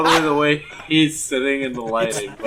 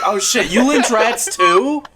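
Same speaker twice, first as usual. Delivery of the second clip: imitating her amazed discovery, pitched up; mock-thrilled question, higher and louder at the end; delighted surprise; 7 semitones higher, 4 dB louder, slower than usual. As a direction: play a pleasantly shocked admirer. Acted delighted reaction on meeting.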